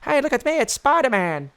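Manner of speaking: high-pitched costumed entertainer voice; hammed-up superhero entrance for a child; Spider-Man. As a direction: play it big and high for the kid. Acts performer playing Spider-Man to kid.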